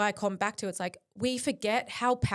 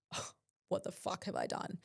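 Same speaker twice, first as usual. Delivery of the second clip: a retold shocked thought, said much softer and under the breath; hushed inner thought; 9 dB quieter than usual. Recalling her hospital moment to the host, hushed and stunned. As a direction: let it slip out quietly, stunned at yourself.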